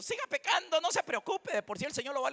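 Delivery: high-pitched, soft mock reassurance; mocking imitation. Preacher mimicking those who excuse sin, mocking. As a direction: parody a falsely comforting preacher.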